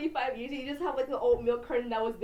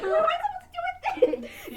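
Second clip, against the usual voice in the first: put-on high whiny kid voice; 4 dB louder than usual. Kid reacting to dad.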